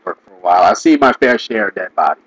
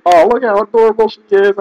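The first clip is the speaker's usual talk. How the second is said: gushing, pitched much higher; mock gushing fan; 10 semitones higher than usual. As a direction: coo it like a smitten fan, half-mocking. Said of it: "'Cause it's just like".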